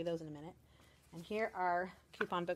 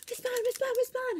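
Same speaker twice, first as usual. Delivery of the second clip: put-on very high, louder eager kid calling the teacher; excited child calling; 6 dB louder than usual. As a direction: call out like an eager first-grader. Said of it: "came in excitedly going".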